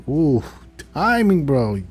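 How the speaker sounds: loud, high mock dismay; playful, exaggerated scolding of a bro; exasperated. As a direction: wince at terrible timing, voice jumping up. Exasperated reaction to badly timed confession.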